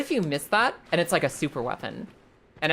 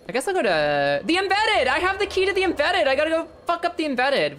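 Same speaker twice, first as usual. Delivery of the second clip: excited; 11 semitones higher, 4 dB louder than usual. Real, not acted. Finds key, rushes to use it.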